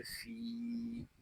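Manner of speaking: फी with a long drawn ee; one-alif stretch. Shown as a demonstration. Teacher shows the ya madd, drawn-out फी. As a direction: stretch the vowel of फी. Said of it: "एक अलिफ तक खींच कर पढ़ेंगे".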